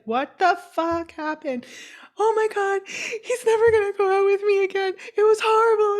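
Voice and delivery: high, loud panicked wail; hammed-up distressed cry; mock distraught friend. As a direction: imitate a friend panicking in tears. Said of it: "I was like, I was like".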